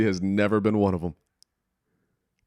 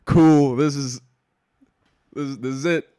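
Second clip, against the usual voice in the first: loud, high mock enthusiasm; a touch of sarcastic cheer; deflated sarcasm; 5 semitones higher, 6 dB louder than usual. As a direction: fake being cool about it. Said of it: "I was like".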